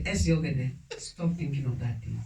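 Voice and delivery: low, soft order to herself; quiet self-command. Retold or imitated. A woman speaking to herself in the toilet mirror, low and soft. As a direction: hush yourself firmly in the mirror.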